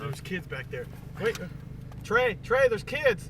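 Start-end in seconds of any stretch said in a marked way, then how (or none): 1.2-3.3 loud, high alarm calling Trey; urgent call. Real, not acted. Warning friend that kids in the back seat can see, loud and high.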